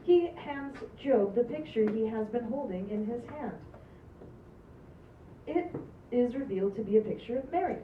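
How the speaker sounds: ordinary talk, nothing marked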